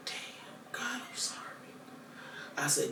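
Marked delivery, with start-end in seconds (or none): 0.1-1.7 hushed whisper to God; whispered plea for forgiveness; whispered prayer. Retold or imitated. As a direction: breathe the apology to God.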